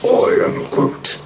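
slow, husky creature voice; Groot voice. Acted. Groot answers his friend, changed creature voice. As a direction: draw it out slowly, almost without voice. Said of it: "even your voice has changed".

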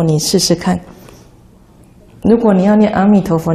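normal talking voice

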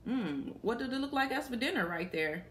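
ordinary delivery, nothing marked

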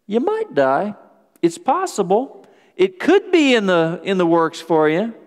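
high-pitched, noncommittal shrug of a warning; airy downplaying. Preacher paraphrasing Eve's softened warning, airily downplaying. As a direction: lift the voice as if it's only a remote chance.

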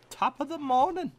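playful hint of Irish brogue; mock Irish accent. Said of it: "just acting like I'm Irish".

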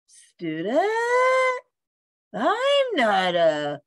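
mildly puzzled, slowed and questioning; confused. A narrator's reading. Confused dog answers a bird who called him student, mildly puzzled. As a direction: trail into a hesitant protest, slightly baffled. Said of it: "Rusty was confused".

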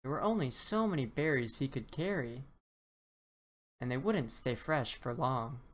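ordinary delivery

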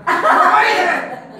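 high, loud, drawn-out question; hammed-up slow shriek of surprise; incredulous mother. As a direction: stretch it out in shocked disbelief. Mother shocked by answer.

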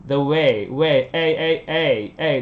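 WAY with full English "ay" glide; English ay diphthong. Shown as a demonstration. Teacher drilling way, English ay sound in WAY. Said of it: "Đừng nói là way theo âm tiếng Việt".

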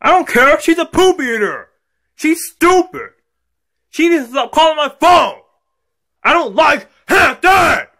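put-on high-pitched angry kid yelling; screaming tantrum. Kid ranting to dad about Hampton, screaming. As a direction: yell it high, like a bratty child. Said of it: "stop screaming".

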